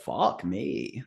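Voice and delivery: muttered under breath. Acted. Innkeeper woman mutters to herself, soft curse. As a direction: swear quietly to yourself. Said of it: "She just mutters under her breath".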